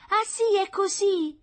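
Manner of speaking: high, loud, drawn-out mocking question; mock him in a high, slow voice; chuckling sneer. A narrator's reading. Concierge taunts the man Meletti, chuckling mockingly. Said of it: "si ridacchiò la portiera".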